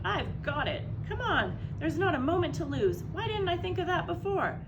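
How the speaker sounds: high, loud burst of sudden inspiration; excited shout. A narrator's reading. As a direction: cry out with the thrill of a new idea, high and loud. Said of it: "He jumped up and shouted".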